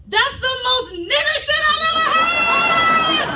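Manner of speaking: high, drawn-out excited shout; wild, loud outburst; blurted out. Retold or imitated. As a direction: burst out at full pitch, stretching the end. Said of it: "and I just blurted out".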